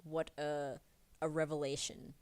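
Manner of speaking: ordinary talk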